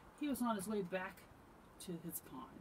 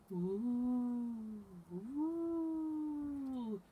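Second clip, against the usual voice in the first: long high hooted wind noise; drawn-out "ooh" of wind in trees; wind howl; 7 semitones higher, 4 dB louder than usual. A narrator's reading. Reader voices night wind in the trees, wind howl. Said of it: "howled the wind".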